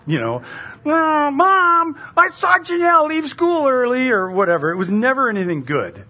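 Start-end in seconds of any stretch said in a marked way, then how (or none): 0.9-4.1 put-on high, loud tattling kid; tattling child. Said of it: "heard that statement a lot".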